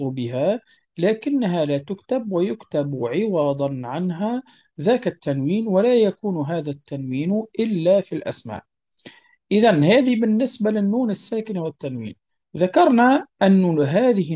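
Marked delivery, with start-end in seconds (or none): none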